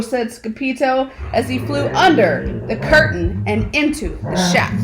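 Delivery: plain reading voice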